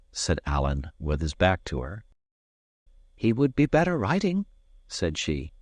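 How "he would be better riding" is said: lightly voiced young lass; a hint of a Scots maid. A narrator's reading. Maid's voice in story.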